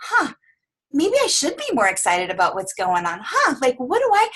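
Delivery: pondering client voice, pitch swinging up on the huhs; musing like someone just catching on; hesitant client. Retold or imitated. Client on the call, catching the energy, hesitant and wondering. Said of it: "they're sitting back like".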